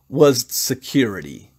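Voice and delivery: usual speaking voice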